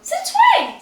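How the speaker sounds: high, shocked since when; surprised outburst. Real, not acted. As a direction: squeal it out in disbelief. Surprised reaction to assigned seats.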